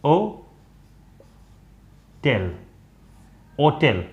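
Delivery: ओ, long pause, टेल, then ओटेल joined; h dropped, syllable by syllable. Shown as a demonstration. Teacher spelling out the old pronunciation, syllable by syllable. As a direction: break ओटेल in two, then say it whole without h. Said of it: "इसको pronounce करते थे ओटेल".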